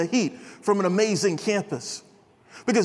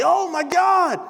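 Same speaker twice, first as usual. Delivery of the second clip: high-pitched mock panicked cry; acted alarm, pitched well up; freaking out; 7 semitones higher, 3 dB louder, slower than usual. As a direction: imitate someone freaking out in the backyard. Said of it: "they hear somebody freaking out".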